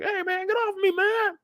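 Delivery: high panicked mock cry; imitating the owner yelling from the ground; screaming victim. Pinned owner screaming at Lamar to get off. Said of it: "He's kicking and screaming like".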